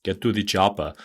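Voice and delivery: put-on Austrian movie-star accent, lightly done; a quick, half-serious Austrian action-hero voice; Arnold Schwarzenegger accent. Host tries Schwarzenegger's Austrian accent on a famous line. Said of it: "I was gonna do his accent then".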